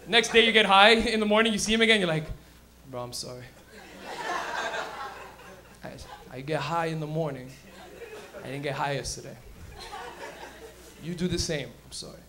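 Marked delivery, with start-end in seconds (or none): 2.9-9.4 much lower, softer, laughing through it; apologizing to the coworker, chuckling; sheepish apology. Acted. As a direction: mumble an embarrassed sorry. Sheepish apology to coworker next day.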